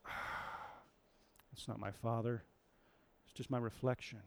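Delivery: soft, let-down murmur; disappointed hush. Retold or imitated. Simba seeing only his reflection, softly disappointed. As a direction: sink into disappointment, quietly.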